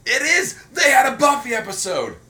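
high, loud excited outburst; excited shout. Real, not acted. Co-host thrilled at remembering the episode, excited shout. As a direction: shout it out, pitch way up. Excited realisation about the show.

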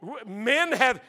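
usual speaking voice

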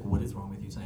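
low, quiet deadpan question; dry grandmother. Retold or imitated. Grandmother to an aggressive grandchild walking in, dryly. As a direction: lightly imitate a dry, unimpressed grandmother. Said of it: "she's very dry".

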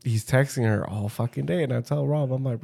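ordinary talk

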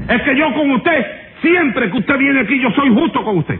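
juez exasperado, tono bastante más agudo; exasperación. Acted. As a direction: protesta con la voz en alto registro. Juez protesta exasperado ante el reo. (queja aguda y acalorada)

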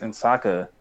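usual speaking voice

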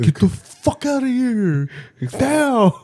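high, loud drawn-out shout; acted-out kid yelling; mock yell. Acting out the kids' fight.

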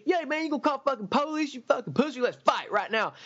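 high, belligerent dare; drunk taunting. Retold or imitated. Alan daring the neighbour to fight, mock belligerent. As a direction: goad him into a fight, sloppy drunk.

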